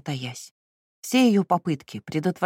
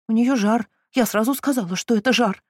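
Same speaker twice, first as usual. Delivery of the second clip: moaning; 7 semitones higher, 5 dB louder than usual. Acted. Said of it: "простонала кузина Стиклс".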